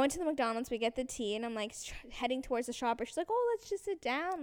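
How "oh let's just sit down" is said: high, casual mom voice; lightly acted, pitched-up mother; imitated mom. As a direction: play mom making an innocent suggestion. Imitates mom suggesting they sit.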